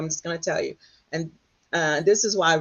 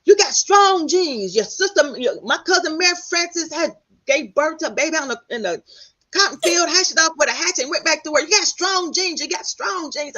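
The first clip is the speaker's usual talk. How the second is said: dad's voice; 9 semitones higher, 4 dB louder than usual. Retold or imitated. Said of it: "And my dad's like".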